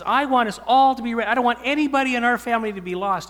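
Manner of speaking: put-on a small girl insisting at the dinner table; little daughter preaching. Father voicing his daughter urging the family at dinner, in a high urgent child voice. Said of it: "And she says / she was preaching".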